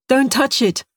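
a wary survivor's quick warning; urgent warning. Acted. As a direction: stop him before he touches it. Survivor warns from the fire.

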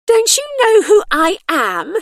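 very high, loud, outraged starlet; indignant demand. A narrator's reading. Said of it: "demanded the woman".

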